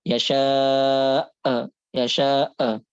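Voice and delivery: YASHA'A with a long vowel, then a forced, detached 'a; exaggerated hamzah. Shown as a demonstration. Teacher shows students the forbidden retching-like reading, overdone hamzah in YASHA'A. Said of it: "seperti orang muntah tadi, nggak boleh".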